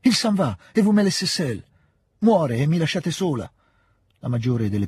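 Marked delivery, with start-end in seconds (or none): 0.0-3.5 very high, anguished wail; desperate. A narrator's reading. Princess left alone with the dying man, desperate cry. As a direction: wail it out, pitch far up. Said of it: "proferì disperatamente".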